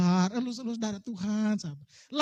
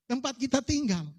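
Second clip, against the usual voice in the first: normal talking voice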